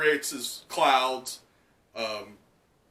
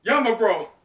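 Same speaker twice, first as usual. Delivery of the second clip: higher, louder call; Luffy shouting; 9 semitones higher, 6 dB louder than usual. Acted. Luffy calling Yamato mid-fight, loud. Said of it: "He goes".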